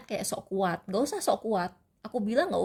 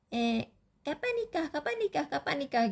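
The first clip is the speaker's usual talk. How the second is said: nagging people; 7 semitones higher than usual. Retold or imitated. People nagging her once she has a boyfriend, high mock pestering. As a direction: chant it like endless pestering from busybodies.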